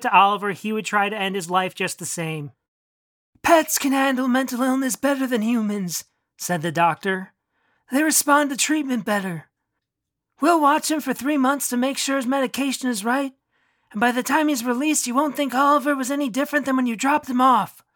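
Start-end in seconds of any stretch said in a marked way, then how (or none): 3.4-6.0 a lightly voiced doctor, a bit higher; calm clinical doctor, slightly raised pitch; lightly played doctor; doctor's voice. A narrator's reading. 7.9-17.8 a lightly voiced doctor, a bit higher; calm clinical doctor, slightly raised pitch; lightly played doctor; doctor's voice. A narrator's reading.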